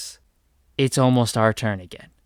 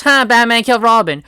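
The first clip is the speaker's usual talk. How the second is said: higher, louder gloating cackle-voice; mocking Harley Quinn; 9 semitones higher, 8 dB louder than usual. Harley Quinn gloating over dead Robin, loud mocking jeer. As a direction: jeer like a clown villain. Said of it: "Harley Quinn laughs at it and goes".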